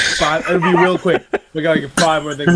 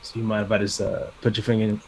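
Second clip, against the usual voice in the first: soft, low, suave ask; cool and smooth; 9 semitones lower, 6 dB quieter, faster than usual. Shown as a demonstration. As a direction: play it smooth and quiet. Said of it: "In, in the coolest way possible".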